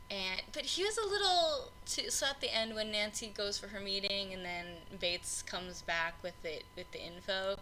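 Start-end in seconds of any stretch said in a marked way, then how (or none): none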